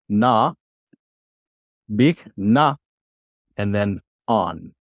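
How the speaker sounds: "B", "na", "on" given one syllable at a time; syllable division. Shown as a demonstration. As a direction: sound out "B, na" and "on" with pauses between. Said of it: "we're doing this is syllable division".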